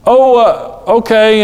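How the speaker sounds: put-on high-pitched voice of a caught kid; sheepish child. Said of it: "they look out there and they say".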